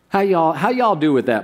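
usual speaking voice

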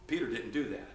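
ordinary delivery

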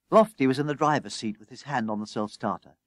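usual speaking voice

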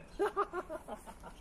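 delighted high giggle at the joke. Real, not acted. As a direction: squeal with amusement, then fade. Laugh after the answer.

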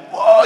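very high whoop of whoa; mock whoa. Pastor describing enthusiastic church dancing, very high whoa. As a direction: yelp it like a wild dancer. Imitating wild dancer.

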